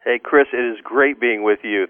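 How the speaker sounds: ordinary talk, nothing marked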